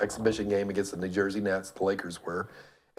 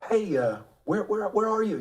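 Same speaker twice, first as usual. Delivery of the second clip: higher-pitched imitation of a phone caller; retold phone call; 11 semitones higher than usual. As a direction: act out checking on a ride by phone. Retold phone call to his ride.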